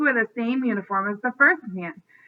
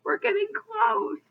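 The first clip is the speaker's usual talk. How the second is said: excited; 11 semitones higher, 3 dB quieter than usual. A narrator's reading. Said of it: "He slapped Stanley excitedly".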